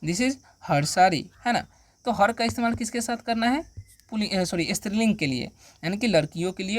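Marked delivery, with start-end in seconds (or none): 0.0-1.2 "This is her saree" drawn out slowly; slow, careful repeat; slow dictation. Shown as a demonstration. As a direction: repeat it slowly so learners follow. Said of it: "यह उसकी साड़ी है".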